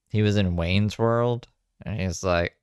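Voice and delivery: ordinary talk, nothing marked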